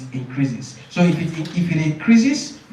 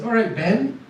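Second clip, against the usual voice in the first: examiner voice; 4 semitones higher than usual. Shown as a demonstration. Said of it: "the examiner to say".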